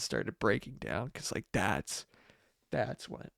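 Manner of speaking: holding back tears as it fades; tearful. Real, not acted. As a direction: voice dropping, close to tears. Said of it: "oh, I'm shedding a tear".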